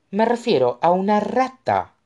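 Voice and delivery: firm and insistent, leaning on it. A narrator's reading. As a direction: say it again, insisting. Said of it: "insistió Isabel". (con insistencia)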